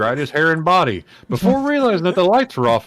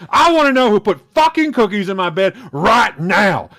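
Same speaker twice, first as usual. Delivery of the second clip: loud, higher furious scream; 7 semitones higher, 5 dB louder than usual. Acted. Wrestler screaming at the pranksters in the dark, furious. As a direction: scream at the top of your lungs. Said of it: "screaming at the top of his lungs".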